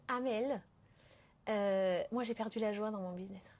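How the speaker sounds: low, hushed mock confession; imitated joyless client. Advanced entrepreneur confiding to her coach, deflated and hushed. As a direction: let it sink to a near-whisper, deflated. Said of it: "qui sont en mode".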